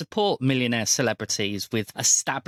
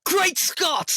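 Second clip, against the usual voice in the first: much higher hammed-up cry of astonishment; startled exclamation. Host answering the time-travel bit, hammed-up shocked cry. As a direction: throw it up high like a shocked old professor.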